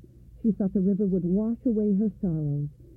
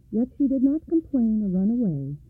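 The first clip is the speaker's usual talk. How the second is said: ordinary delivery, nothing marked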